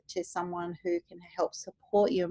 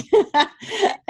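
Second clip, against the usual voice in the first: bright pitched-up laugh; high laugh; 13 semitones higher, 8 dB louder than usual. Real, not acted. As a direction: laugh happily. Laughing about weather.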